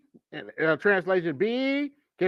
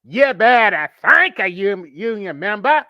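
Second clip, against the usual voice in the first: exaggerated slow Southern drawl, louder; hammed-up mimicry of a politician's Southern twang; mocking Southern accent; 6 dB louder, slower than usual. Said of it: "y'all heard that right".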